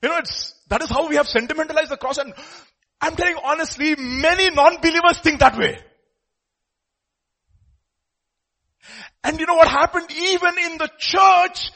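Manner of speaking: high, loud, impassioned sermon; full-force preaching. Real, not acted. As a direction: press the point home at full volume.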